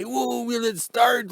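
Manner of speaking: slow, drawn-out mock amazement; mock excited YouTuber. Mocking online videos, a drawn-out hyped-up gasp. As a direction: ham up the breathless viewer hype. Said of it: "everybody goes nuts and say".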